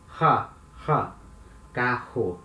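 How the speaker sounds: "Ha, ha" as an H, then "carro" with it; hard H-like R. Shown as a demonstration. Teacher demonstrating double R to students, "carro" with an H sound. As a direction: push out "Ha" and put that H into "carro". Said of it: "this hard sound as letter H".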